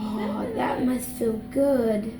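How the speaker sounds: sedated. Real, not acted. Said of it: "Never seen him like this".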